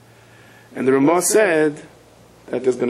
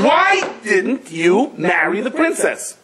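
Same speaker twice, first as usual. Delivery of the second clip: higher, slow and drawn out; pointed question; 9 semitones higher, much slower than usual. Retold or imitated. Wife confronting her husband, slow and high.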